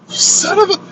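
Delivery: high, indignant cut-off insult; the woman half-yelling at the AI in mock fury; mock outrage. She snaps at the AI in hammed-up anger.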